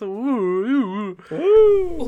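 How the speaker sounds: rising spooky ghost moan; ghostly ooh. Acted. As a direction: wail like a cartoon ghost. Ghostly moans opening spooky episode.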